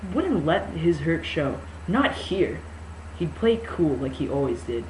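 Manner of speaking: ordinary delivery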